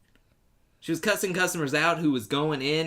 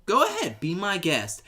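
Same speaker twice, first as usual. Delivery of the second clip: mock breezy go ahead; tossed-off sarcastic permission; 6 semitones higher, 4 dB louder than usual. Mocking a dismissive ex.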